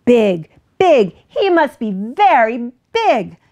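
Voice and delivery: loud, punchy duck outburst; agitated duck; sputtering duck. A narrator's reading. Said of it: "Duck sputtered".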